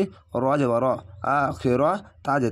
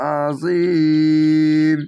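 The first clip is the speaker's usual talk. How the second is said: very long, louder chanted Azim held for three alifs; long recitation; 5 dB louder, much slower than usual. Shown as a demonstration. Teacher showing the three-alif stretch, long loud chant. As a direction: sustain the ee in Azim fully. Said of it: "তিন আলিফ টেনে পড়বেন".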